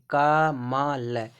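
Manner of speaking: कमल drawn out very slowly, each syllable apart. Shown as a demonstration. Teacher showing learners how कमल is written, syllable by syllable. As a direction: break कमल into three slow, separate syllables.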